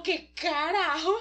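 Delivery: stunned disbelief. Acted. Yu shocked seeing the doctor.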